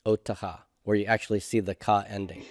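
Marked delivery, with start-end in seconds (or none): 0.0-0.6 soft repeat. Shown as a demonstration. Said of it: "The normal way I would expect to see this form".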